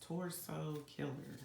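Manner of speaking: slowly, carefully sounded out. Real, not acted. Reader struggling to say torso fast, so saying it slowly. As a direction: take your time over each word. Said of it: "I have to say it slow".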